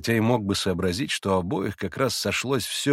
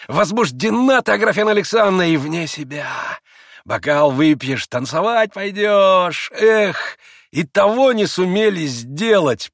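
higher, louder sneering man; mocking Rakitin; 9 semitones higher, 6 dB louder than usual. A narrator's reading. Rakitin taunts Grushenka, sneering and loud. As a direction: tease her with contempt.

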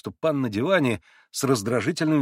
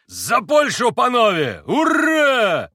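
high, loud cheer with a long hurrah; shouted toast; 12 semitones higher, 7 dB louder, much slower than usual. A narrator's reading. Mitya raises his glass to Poland, shouting jubilantly. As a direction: yell it out in triumph. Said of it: "прокричал Митя".

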